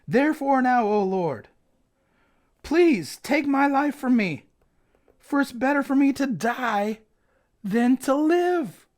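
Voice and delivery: high, loud, slow melodramatic wail; drama tantrum. Acted. Jonah begs God to take his life, melodramatic tantrum. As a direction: ham up a prophet begging to die. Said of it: "Jonah put on a full drama tantrum".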